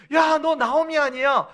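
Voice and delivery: high, bright call of recognition; glad greeting. Retold or imitated. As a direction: greet an old friend after ten years. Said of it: "사람이 만나 반가우면". (반가운 인사)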